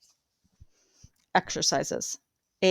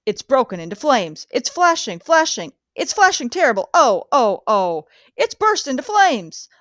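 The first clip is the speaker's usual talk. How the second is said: horrified reporting; 3 semitones higher than usual. Acted. Reporter watching the airship burn before the crowd, horrified and pleading. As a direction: cry out the disaster as it unfolds. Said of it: "describing the crash of the Hindenburg".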